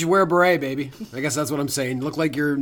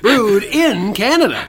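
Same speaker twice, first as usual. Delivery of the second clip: louder, drawn-out announcer sign-off; slow ad tagline; 5 semitones higher, 6 dB louder, much slower than usual. Pretend. Host closes his mock Budweiser ad with a slow booming tagline. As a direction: land the slogan slowly like the ad's last line.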